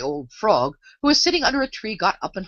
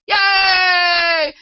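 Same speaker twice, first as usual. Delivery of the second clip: high, loud cheer; a cheering crowd welcomes Queen Alice, high loud yell; 14 semitones higher, 6 dB louder than usual. A narrator's reading. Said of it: "a confused noise of cheering".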